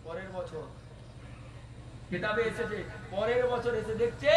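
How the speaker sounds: very soft storytelling; hushed narration. A narrator's reading. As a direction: drop right down and resume the tale quietly. Hushed resumption after the chant.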